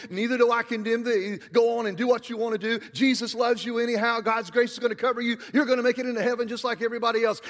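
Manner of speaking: breezy, hurried parody of a lenient Jesus; mock permissive Jesus. Preacher mocking the world's version of Jesus, breezy and fast. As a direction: rattle it off like a too-easy gospel, fast.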